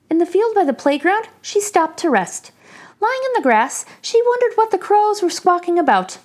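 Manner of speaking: ordinary delivery, nothing marked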